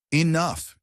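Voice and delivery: sharp, loud, high bitten-off word; bitten-off anger. A narrator's reading. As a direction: cut him off hard. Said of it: "he bit out, his voice dropping to a dangerous whisper".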